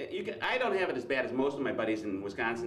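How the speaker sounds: ordinary talk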